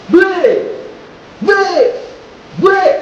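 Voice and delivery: high barked calls of break; sharp commands. Shown as a demonstration. Coach barking break at the class, high and loud. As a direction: snap each one out. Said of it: "take it three times".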